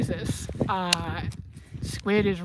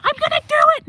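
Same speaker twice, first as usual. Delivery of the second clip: hammed-up squeal, far higher and louder; eager horse voice; 9 dB louder, much faster than usual. Squid tensing up near cows, squealing loud eager threat. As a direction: play a horse itching to charge the cows. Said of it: "She's like".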